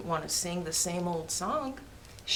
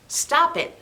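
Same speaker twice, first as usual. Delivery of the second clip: stern stop; 6 semitones higher, 6 dB louder than usual. Acted. Crow stops the silliness.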